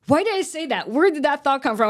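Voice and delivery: high, loud, startled self-questioning; aghast at your own words; alarmed disbelief. Retold or imitated. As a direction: blurt it out in comic panic. Acted panic at own rash promise.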